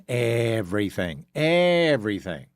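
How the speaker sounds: "everything" repeated with a long stretched first syllable; emphatic EVERYTHING. Real, not acted. Host complaining that Virginia is taxing everything, stretched emphasis. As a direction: draw out "everything" both times.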